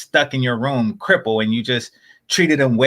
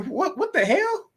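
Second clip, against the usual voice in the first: pitched way up in laughing disbelief; squeaky, amused outrage; incredulous; 5 dB quieter than usual. Real, not acted. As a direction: throw it up high, half laughing, can't believe it. Incredulous reaction to ungrateful billionaire.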